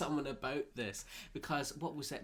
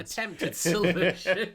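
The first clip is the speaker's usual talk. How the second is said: laughing; 7 semitones higher, 10 dB louder than usual. Real, not acted. Laughs while echoing co-host.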